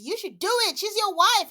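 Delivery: high, loud, pushy pastor. Retold or imitated. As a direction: bark it out like an eager pastor. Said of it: "Pastor Cal is just".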